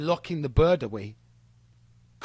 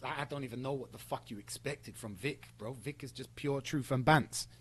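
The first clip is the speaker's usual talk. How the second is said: much softer, low aside; quiet retold quote; 3 semitones lower, 9 dB quieter than usual. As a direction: hand it over calmly and quietly. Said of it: "Logan says to him".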